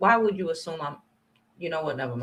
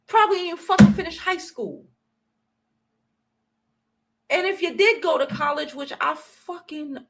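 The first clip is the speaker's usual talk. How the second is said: higher, heated ranting; higher, louder angry tirade; 8 semitones higher, 5 dB louder than usual. Real, not acted. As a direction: keep lashing out. Ongoing loud rant.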